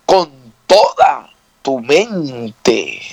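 very slow, stretched "toda" and "mente"; stress on TODA. Shown as a demonstration. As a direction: hold "toda", pause, then draw out "mente". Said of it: "Escuche bien".

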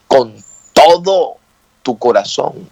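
"todo" slow and stretched, then a pause; stress on TODO. Shown as a demonstration. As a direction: lean hard on "todo", then drop for the rest. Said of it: "Y escucha cómo dice".

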